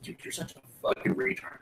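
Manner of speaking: mock whispered insult. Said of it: "everyone's like".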